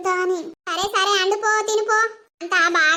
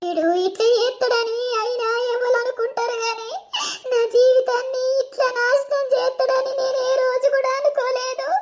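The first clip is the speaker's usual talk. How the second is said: higher-pitched, anguished wail; crying; 4 semitones higher than usual. Acted. Beaten wife alone, calling for mother, wailing. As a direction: sob out the pain, drawing out the cries. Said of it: "ఏడుతున్నావు?".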